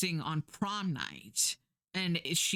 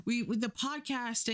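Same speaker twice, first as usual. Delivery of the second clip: higher, chummy nodding cops; higher mock casual cop voice; eager cops; 8 semitones higher than usual. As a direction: play the police as overeager. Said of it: "the police are like".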